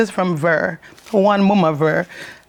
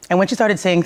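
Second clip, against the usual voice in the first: ordinary talk, nothing marked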